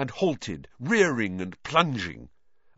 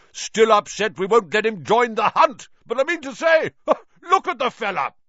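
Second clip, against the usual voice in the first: loud, much higher jeer with a laugh; loud mocking; 12 semitones higher, 8 dB louder than usual. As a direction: shout the jibe, laughing. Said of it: "shouted Sir Patrick's head".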